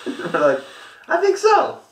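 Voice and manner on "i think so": high, put-on sneaky voice; mock conspiratorial. Buddies passing the high school, mock conspiratorial question. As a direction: play schemers spotting their target in a squeaky voice. Boys confirming the target car.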